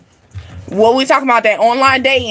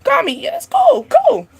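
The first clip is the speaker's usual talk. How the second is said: high-pitched airy "it's cool"; put-on nonchalance, pitch jumping up; mock unbothered; 14 semitones higher than usual. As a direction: shrug it off way up high. Acting unbothered by name-calling.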